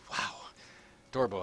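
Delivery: ordinary talk, nothing marked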